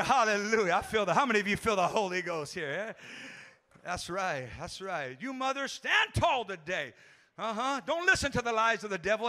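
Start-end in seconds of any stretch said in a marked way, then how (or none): none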